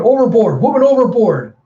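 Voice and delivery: high, loud shipboard shout; alarm call. Acted. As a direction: raise the alarm across the deck. Alarm for woman overboard.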